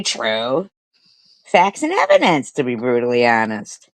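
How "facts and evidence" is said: mocking high pitch. Real, not acted. Mocking exaggeration.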